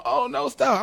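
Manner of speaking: high fake pleading; mock crying kid. Mom wanting a begging kid, high mock plea. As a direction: wail it like a scared kid. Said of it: "wasn't crying and going".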